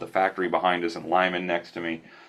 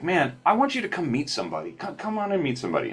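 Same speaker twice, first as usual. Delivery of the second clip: eager invitation; 10 semitones higher, faster than usual. Retold or imitated. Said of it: "he's like".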